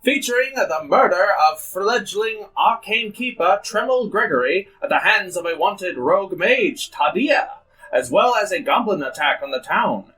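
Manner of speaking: put-on grand old ballroom announcer; old-timey announcer. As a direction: boom it like vintage radio host. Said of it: "Go to the old-timey announcer".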